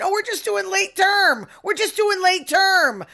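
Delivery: high mock-innocent protest. Mimicking politicians' excuses on abortion, mocking and high. Mocks the other side's excuse.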